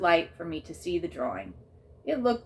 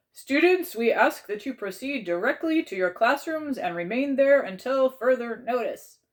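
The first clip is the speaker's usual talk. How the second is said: loud, higher announcement over a crackly intercom; 8 semitones higher, 6 dB louder than usual. A narrator's reading. School intercom ordering students to classrooms, crackly. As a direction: read it like a school PA. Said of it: "a crackly voice over the intercom".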